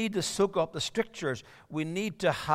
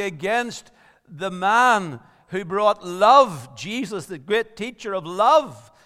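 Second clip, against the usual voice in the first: louder, higher, impassioned preaching; preaching at full force; 5 semitones higher, 8 dB louder than usual. Acted. Preacher asking the congregation why persecutors rage, impassioned and louder. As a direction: build the question to a passionate cry.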